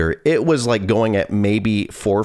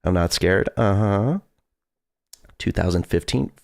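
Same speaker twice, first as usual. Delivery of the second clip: normal talking voice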